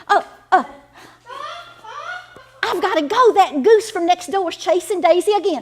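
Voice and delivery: put-on suddenly panicked for her child; flustered alarm. Goose chasing her daughter.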